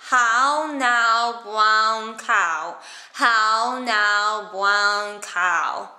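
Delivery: "How now, brown cow" said with the tongue held behind the teeth. Shown as a demonstration. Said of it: "with the tongue still behind the teeth".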